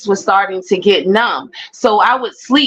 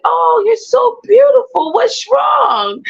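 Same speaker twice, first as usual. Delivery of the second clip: nurse's high cooing; 14 semitones higher, slower than usual. Retold or imitated. Retelling the nurse fussing over her, high cooing. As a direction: coo over her like a baby. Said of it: "And she was like".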